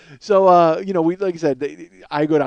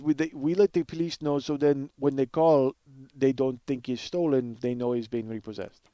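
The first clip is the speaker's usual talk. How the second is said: Russian guy's voice, slowing and sinking softer; Russian accent; 3 semitones lower, 4 dB quieter than usual. Acted. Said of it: "I love doing this Russian guy's voice".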